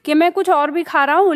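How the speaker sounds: ordinary talk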